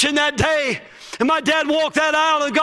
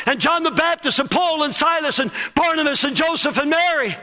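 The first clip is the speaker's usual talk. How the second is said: usual speaking voice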